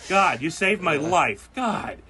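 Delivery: high, loud overdone thanks; mock gratitude. Driver thanking the attendant with loud mock gratitude. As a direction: gush with fake relief.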